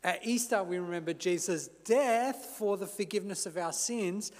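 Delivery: ordinary talk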